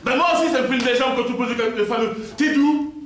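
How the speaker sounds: loud and high, fed up; comic outburst, voice climbing; exasperated rant. Real, not acted. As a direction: vent it like you've heard it a hundred times. Comic rant about the question.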